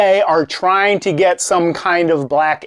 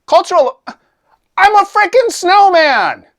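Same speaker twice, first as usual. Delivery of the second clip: high, loud, outraged squawk; indignant snowman; 13 semitones higher, 4 dB louder than usual. Acted. Snowman protesting to the bartender, high outraged cry.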